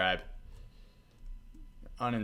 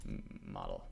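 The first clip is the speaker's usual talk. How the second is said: mock-epic "model", much lower and softer; deep dramatic reveal; 11 dB quieter than usual.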